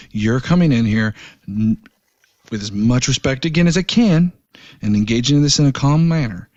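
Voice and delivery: ordinary talk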